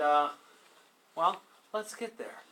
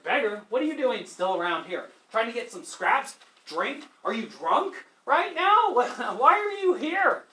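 shrill-free hammed-up scolding, rising higher and louder; mock scolding maid; 5 semitones higher, 6 dB louder than usual. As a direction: sneer at the beggar, building to a laugh. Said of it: "she scolds Odys".

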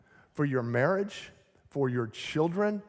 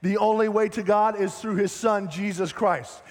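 ordinary talk, nothing marked

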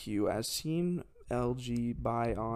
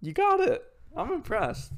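excited; 5 dB louder than usual. Real, not acted. Praising correct answer.

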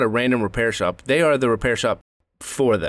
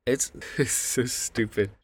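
laughing softly through the words; soft exasperated laugh, slow; 7 dB quieter, slower than usual. Real, not acted. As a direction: let it dissolve into a quiet laugh.

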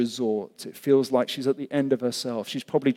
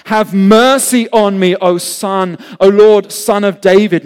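loud, raised plea for mercy; crying out; 6 semitones higher, 11 dB louder than usual. Acted. Woman cries to Jesus for mercy, loud pleading cry. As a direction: cry out to him for help. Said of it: "She's come with a cry".

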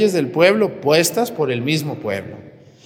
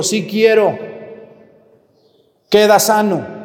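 raised, deliberate pronouncement; solemn healing declaration; 5 semitones higher than usual. Acted. Jesus answers the leper, solemn and raised. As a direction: declare it firmly, with space between phrases.

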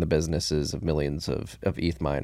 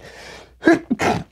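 sudden sharp sneeze; sneezing; 8 dB louder than usual. Real, not acted. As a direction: let out a real sneeze. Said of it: "oh, I gotta sneeze again".

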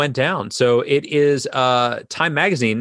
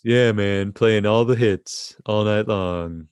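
late-night radio DJ; 2 semitones lower than usual. Pretend.

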